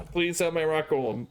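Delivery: ordinary delivery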